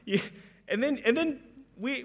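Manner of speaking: normal talking voice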